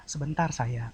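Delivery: soft, low, affectionate answer; reply gently to a loved one; sweet reply. Acted. Spouse asked to make coffee, sweet reply. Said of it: "Ntar kita tuh marah apa enggak".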